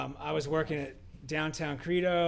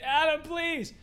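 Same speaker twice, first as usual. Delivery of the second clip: pleading; 9 semitones higher than usual. Retold or imitated. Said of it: "calls from Paul saying".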